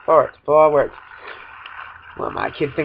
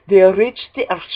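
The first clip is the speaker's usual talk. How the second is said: ordinary talk, nothing marked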